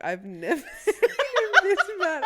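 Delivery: high laughing retelling; giggling imitation; laughing quote. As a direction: quote her while cracking up. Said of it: "even Brie was like".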